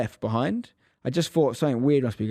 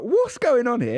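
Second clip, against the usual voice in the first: put-on much higher, louder incredulous kid; stunned question; 11 semitones higher, 4 dB louder, slower than usual. Boy finds his dad calmly driving home, high and stunned. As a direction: blurt it in disbelief.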